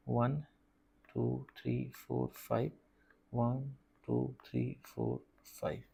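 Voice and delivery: soft, slow count in separate beats; counting to oneself. Real, not acted. Teacher marking off numbers for the homework, quiet counting to oneself. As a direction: count off quietly, as if only for yourself.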